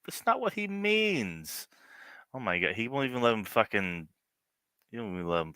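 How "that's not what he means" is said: higher-pitched, incredulous protest; can't believe what he's hearing; squeaky outraged disbelief; exasperated. Real, not acted. Streamer exasperated at the clip.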